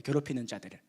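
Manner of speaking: normal talking voice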